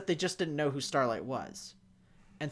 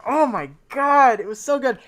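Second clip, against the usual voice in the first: flustered excitement; 6 semitones higher, 10 dB louder than usual. Real, not acted. Fan raving to a co-host about the episode, flustered and thrilled. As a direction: gush it out, stretching the first words. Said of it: "flustered here".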